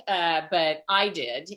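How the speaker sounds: ordinary talk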